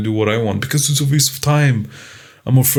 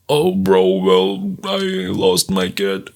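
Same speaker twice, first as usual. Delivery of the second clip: macho bro; 2 semitones lower, slower than usual. Retold or imitated. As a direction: mumble it slowly like a sad dude. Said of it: "they're like".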